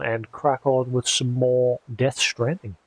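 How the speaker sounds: usual speaking voice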